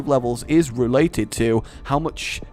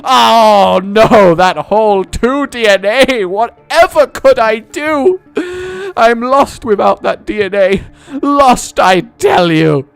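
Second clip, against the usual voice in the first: hammed-up wail, much higher and louder; mock despair; 8 semitones higher, 8 dB louder, slower than usual. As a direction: overact the tragic loss. Mock despair over lost DNA.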